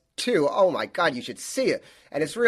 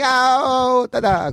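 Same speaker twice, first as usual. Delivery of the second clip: mock pained cries; 9 semitones higher, 5 dB louder, much slower than usual. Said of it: "I kept going".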